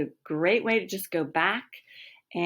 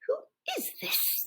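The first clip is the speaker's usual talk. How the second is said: annoyed; 7 dB quieter than usual. Acted. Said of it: "The voice sounds annoyed now".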